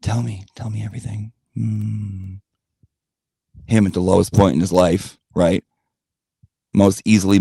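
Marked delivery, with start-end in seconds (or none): 0.0-2.3 soft hypnotic snake voice; a hint of Kaa, quiet and lulling. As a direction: coax it like a mesmerizing snake. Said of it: "Kaa with the eyes?".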